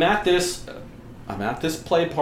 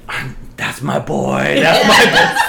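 laughing protest; 7 dB louder, slower than usual. Retold or imitated. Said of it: "I just looked at the daddy and I said".

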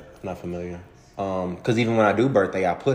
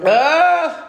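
sneezing; 7 dB louder than usual. Real, not acted. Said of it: "That's how I get it out".

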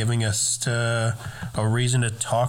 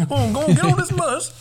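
high mock voice; 4 dB louder, faster than usual. Shooing voice, very high, far.

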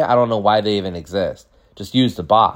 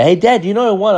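put-on eager kid voice; 13 semitones higher, 5 dB louder, faster than usual. Asking dad who won.

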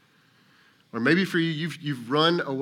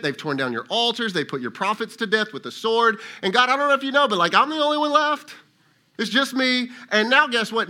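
higher, louder, worked-up complaint; complaining Elijah; 5 semitones higher, 4 dB louder than usual. Retold or imitated. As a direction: pour out Elijah's grievances to God. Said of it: "He says".

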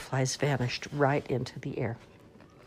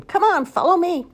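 much higher, louder chick voice; chirpy chick; 14 semitones higher, 8 dB louder than usual. Acted. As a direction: chirp it out like a proud little chick leading the line. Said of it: "in front chirping".

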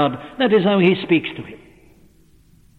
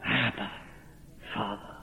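much softer and lower, barely breathed; hushed cry; 7 semitones lower, 15 dB quieter than usual. Acted. Preacher voicing the believer's cry, hushed and low. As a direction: murmur it in awe and reverence. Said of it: "whereby we cry".

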